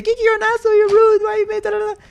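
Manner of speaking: very high squeaky mock complaint; mocking gamers. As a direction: mimic whiny gamers in falsetto. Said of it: "they come in and say".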